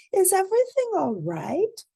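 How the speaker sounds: high, loud caring question; kindly old lady checking in; old grandma voice. A narrator's reading. Grandmother asking.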